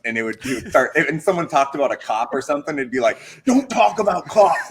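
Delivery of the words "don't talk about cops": put-on thick Boston accent, higher, louder and slow; buzzed Boston guy. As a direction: play the tipsy Boston roommate warning you off. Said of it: "a guy from Boston".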